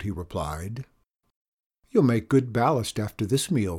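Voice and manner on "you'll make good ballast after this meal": usual speaking voice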